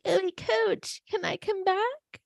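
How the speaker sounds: high, soft mock plea; meek pleading player. Imagined player asking coach to return, mock meek plea.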